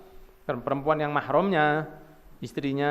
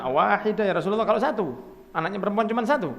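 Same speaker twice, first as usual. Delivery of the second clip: higher-pitched pleading question; anxious questioner; 5 semitones higher, faster than usual. Acted. Follower asking the Prophet about having one daughter, high pleading voice. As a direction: ask it high, as a hopeful follower. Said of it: "Satu lagi mengatakan".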